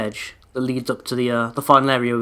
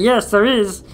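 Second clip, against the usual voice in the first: higher, louder mock yell at the screen; mock viewers shouting; 9 semitones higher, 4 dB louder, slower than usual. Viewers yelling the answer at the screen, mock excited shout. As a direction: play the viewers calling out the answer. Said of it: "you're like".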